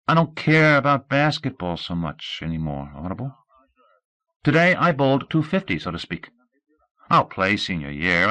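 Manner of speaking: steady and sensible, reasoning with her; earnest young Scout. Acted. Scout reasons with Audrey about their future, earnest.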